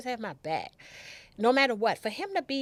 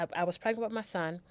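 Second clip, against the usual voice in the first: normal talking voice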